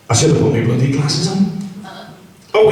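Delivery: ordinary talk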